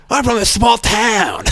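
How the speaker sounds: high, slow, drawn-out comic Australian voice; hammed-up comedian impression; Sam Campbell. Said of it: "do Sam's voice".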